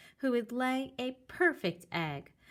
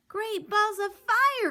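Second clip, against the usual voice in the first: high loud exclaim; goose crying out; 12 semitones higher, 6 dB louder than usual. Acted. Goose bursts out at Jack, high loud cry. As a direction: shriek it out. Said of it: "cried the goose".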